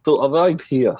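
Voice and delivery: mock-German villain accent, played for laughs; bad German accent. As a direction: put on a badly faked German accent. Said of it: "I can't do a German accent".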